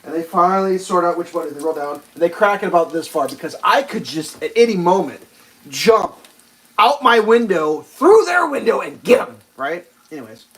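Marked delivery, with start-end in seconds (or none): none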